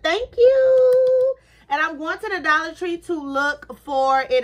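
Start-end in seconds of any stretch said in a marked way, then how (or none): 0.0-1.3 much higher and louder, squealing thanks; a burst of giddy gratitude; excited thanks. Real, not acted. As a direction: squeal your thanks to the sender. Thrilled thanks for a gift.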